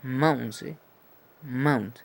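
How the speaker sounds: Mount with A gliding into U; English diphthong AU; A to U glide. Shown as a demonstration. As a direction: say Mount, A sliding to U. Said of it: "A con una U".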